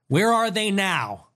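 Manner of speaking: ordinary delivery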